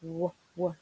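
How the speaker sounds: "W, w" as short "wuh, wuh"; letter sound demo. Shown as a demonstration. Teacher recaps the letter's sound, "W, w" said as "wuh, wuh". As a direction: sound out "W, w" as the bare consonant, twice. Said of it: "gives that W sound".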